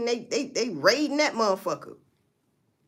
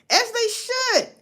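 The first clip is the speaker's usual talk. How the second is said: emphatic approval; 12 semitones higher, 4 dB louder, slower than usual. Real, not acted. Emphatic agreement with the policy.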